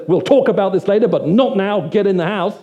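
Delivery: mock telling-off from an angry father; stern scolding. Middle Eastern father ordering his older son inside, stern scolding.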